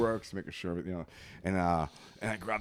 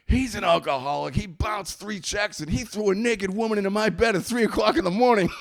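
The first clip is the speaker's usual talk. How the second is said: high, loud, indignant mock accusations; hammed-up, high-pitched tattling; tattling roommate; 11 semitones higher, 5 dB louder than usual. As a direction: imitate a roommate frantically denouncing someone. Roommate accusing him before the police.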